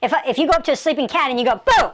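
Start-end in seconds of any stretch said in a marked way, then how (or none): 1.6-1.9 short, loud pretend scare; a sudden mock fright-giver; sudden startling shout. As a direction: pounce on it to startle a cat. Startles a sleeping cat.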